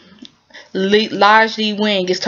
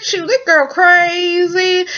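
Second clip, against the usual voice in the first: high, slow sing-song brush-off; sly player; 10 semitones higher, slower than usual. Retold or imitated. Player shrugging off his girlfriend to the shop lady, high sing-song. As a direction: toss it off like a smooth player. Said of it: "And he said".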